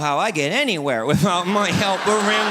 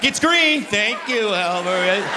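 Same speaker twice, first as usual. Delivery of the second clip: wife pointing out light; 4 semitones higher, 3 dB louder, much slower than usual. Retold or imitated. Wife's helper voice in car.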